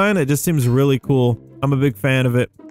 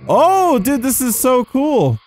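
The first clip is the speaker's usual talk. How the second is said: thrilled; 8 semitones higher, slower than usual. Real, not acted. Gamer thrilled at a map he found, excited. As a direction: burst out excited, pitched well up.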